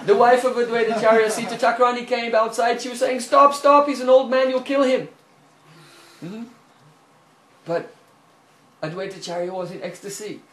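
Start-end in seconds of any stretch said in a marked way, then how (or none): 3.2-5.1 high, loud begging cry; frantic plea. Acted. The wife pleads with Mahaprabhu to stop beating her husband. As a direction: shout at him to stop.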